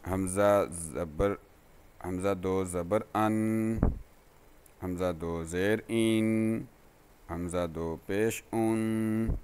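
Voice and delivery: slow, even sing-song spelling chant; recitation drill. Shown as a demonstration. Teacher drilling students on tanween sounds, in an even sing-song chant. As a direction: spell out each letter like a patient Qaida teacher.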